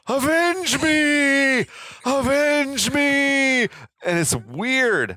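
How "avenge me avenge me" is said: high, slow, drawn-out scream; overacted death scene. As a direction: bellow it like a dying hero. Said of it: "Dad flies into a rage and he starts screaming at him".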